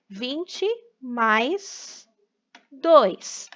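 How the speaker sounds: each word apart, with pauses; slow, spaced out. Shown as a demonstration. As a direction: say the sum slowly, word by word. Teacher spelling out the example sum.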